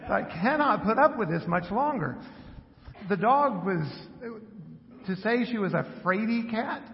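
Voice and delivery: ordinary talk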